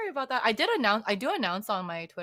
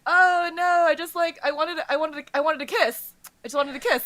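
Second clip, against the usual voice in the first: high, loud mock-innocent voice; flustered excuse; 7 semitones higher, 7 dB louder than usual. Disguised bride caught by the demon, flustered. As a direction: blurt out a sheepish cover story, pitched up.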